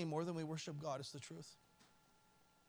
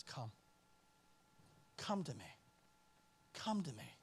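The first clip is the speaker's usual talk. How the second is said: low and much softer pleading; gentle call; 7 semitones lower, 8 dB quieter than usual. Acted. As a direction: draw them in softly. Quoting the Lord's gentle call.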